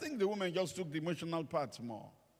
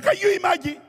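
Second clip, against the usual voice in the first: high, loud incredulous cry; big hammed-up indignation; mock outrage; 16 dB louder than usual. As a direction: exclaim it in disbelief, pitched way up. Retelling his outraged reply, high yell.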